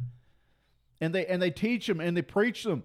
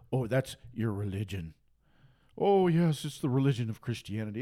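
lower, dismissive sneering voice; mock critic; 8 semitones lower than usual. Critics dismissing the preacher's faith, low sneering mimicry. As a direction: brush him off like a smug critic.

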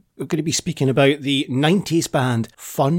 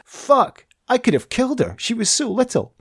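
mock horror, pitched up high; shocked inner thought; 10 semitones higher than usual. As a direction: act out the dawning panic. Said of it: "And I thought".

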